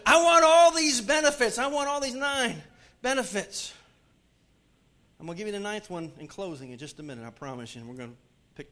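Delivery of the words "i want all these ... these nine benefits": higher, louder greedy plea; whiny mock churchgoer. A preacher mocks a greedy congregant, higher and louder, pleading. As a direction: mimic a congregant grabbing for blessings. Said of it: "I know what some of you think".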